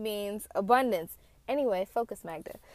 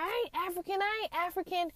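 high, softer mock protest; retold insistence in a raised, small voice; protesting denial; 9 semitones higher, 5 dB quieter than usual. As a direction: deny it repeatedly, pitched way up. Acts her argument with the girls.

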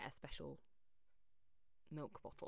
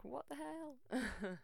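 shocked; 8 semitones higher, much slower than usual. Retold or imitated. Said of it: "she was like".

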